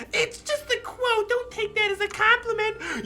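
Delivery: put-on high teasing girl voice. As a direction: tease in a high girly voice. Voicing Natsuki.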